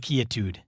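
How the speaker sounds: "quietude" with KEE in place of KWY; quietude as key-a-tood. Shown as a demonstration. Podcaster settling on a name for the boots, "quietude" said KEE-uh-tood. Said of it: "I would name it".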